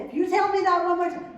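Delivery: loud, high exasperated retort; fed up, warning tone; husband snapping back. Retold or imitated. Husband fed up with wife's nagging, loud exasperated retort. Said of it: "And he'd say".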